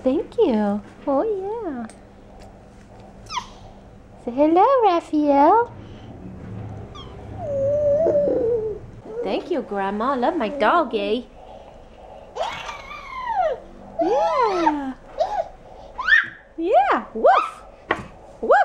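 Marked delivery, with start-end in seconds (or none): 1.0-17.6 put-on talking to a toddler. Baby talk with toddler. 18.4-18.5 high playful woof. Pretend. Barking for the dog.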